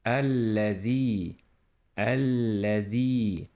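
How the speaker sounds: الذي with a held doubled l; doubled lam. Shown as a demonstration. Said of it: "This is doubling la".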